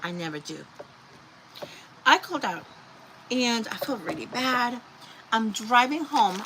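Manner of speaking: usual speaking voice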